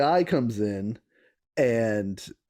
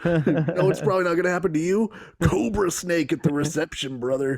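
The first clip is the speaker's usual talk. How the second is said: Macho Man voice; 6 semitones higher than usual. Retold or imitated. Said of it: "Yeah, listen up, brother".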